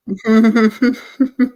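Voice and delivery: short awkward giggle; uneasy laugh, a bit embarrassed; nervous laugh. Real, not acted. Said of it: "That's a nervous laugh".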